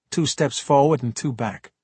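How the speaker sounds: ordinary delivery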